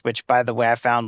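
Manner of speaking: usual speaking voice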